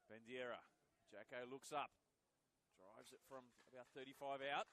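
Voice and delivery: loud, rising call as Jacko shoots; live play-by-play. Acted. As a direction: commentator lifts for the strike.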